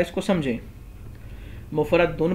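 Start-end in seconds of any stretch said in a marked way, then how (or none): 1.7-2.3 مفرد دن read mufradun, with an u in the ending. Shown as a demonstration. Teacher showing a valid reading, مفرد دن ending in -dun. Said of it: "پڑھنا بھی درست ہے".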